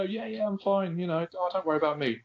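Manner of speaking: chirpy fake fine; fake cheerful reassurance. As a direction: pretend all is fine, lightly. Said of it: "And then you're actually really going".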